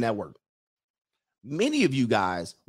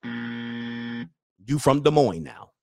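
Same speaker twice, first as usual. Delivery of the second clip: slow teasing; much slower than usual. Acted.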